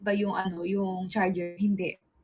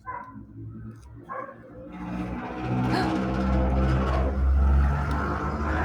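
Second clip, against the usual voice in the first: long gasp. Real, not acted. Long reaction to the gift.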